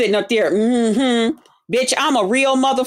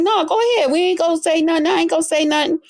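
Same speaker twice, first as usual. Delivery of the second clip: higher mocking voice of Lemon; mimicking Lemon; 6 semitones higher, faster than usual. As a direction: parrot him in a raised, mocking voice. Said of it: "He was like".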